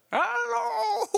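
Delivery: very high, goofy comic squeal; Adam Sandler. Shown as a demonstration. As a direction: break into a silly high-pitched put-on voice. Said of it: "it just goes right to Adam Sandler".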